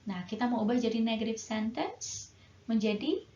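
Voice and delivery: normal talking voice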